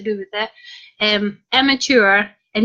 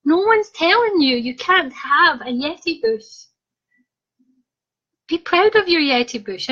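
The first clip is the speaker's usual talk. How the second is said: mock encouraging; 5 semitones higher than usual. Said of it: "That's what I say".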